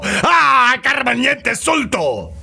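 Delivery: high, excited fake-Spanish riffing; mock Spanish accent. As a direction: parody a comic's over-the-top Spanish accent. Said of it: "repeating what she said in an accent".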